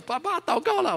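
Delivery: put-on a little boy calling again and again; child calling out. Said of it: "就一直叫".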